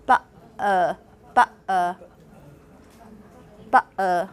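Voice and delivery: ba-, uh: glottal catch, then a bare schwa; East London schwa ending. Shown as a demonstration. Teacher imitates London reduction for fun, ba-, uh with schwa. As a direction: drop the N, ba-, uh, throat catch between. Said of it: "syllabic nasal into just a schwa".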